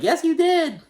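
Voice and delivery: squeaky-high, punchy "yes you did"; high mocking insistence. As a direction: shoot it back in a high, teasing voice. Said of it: "everyone's like".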